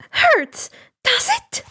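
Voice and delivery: mock him, furious and shrill; sarcastic angry high. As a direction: pitch shooting up, icy sarcasm. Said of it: "Inko glared".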